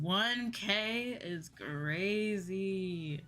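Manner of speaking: usual speaking voice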